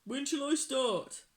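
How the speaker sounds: high, loud troll voice; eager troll. A narrator's reading. Troll asks about new job, high and eager.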